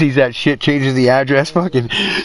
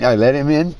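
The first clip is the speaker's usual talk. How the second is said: normal talking voice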